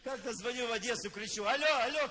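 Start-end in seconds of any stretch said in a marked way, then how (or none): 1.4-2.1 loud, high phone shout. Retold or imitated. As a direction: yell over a bad line. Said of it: "кричу громко".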